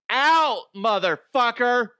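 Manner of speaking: high, loud, sassy ghost yelling; diva ghost yell. Pretend. Imagined diva ghost ordering them out, high loud yell. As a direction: belt it out like an offended ghost diva.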